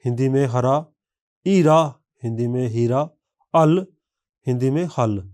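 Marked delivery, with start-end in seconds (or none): 1.5-1.8 ईरा said with a falling tone, louder, no h sound; ईरा as in Dogri, not हीरा; Dogri tonal word. Shown as a demonstration. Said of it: "इसका उच्चारण तान में बदल जाता है". 3.5-3.9 अल said with a falling tone, louder, no h sound; अल as in Dogri, not हल; Dogri tonal word. Shown as a demonstration. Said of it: "इसका उच्चारण तान में बदल जाता है".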